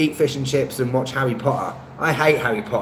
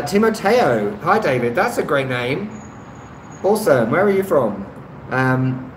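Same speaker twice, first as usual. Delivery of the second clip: ordinary delivery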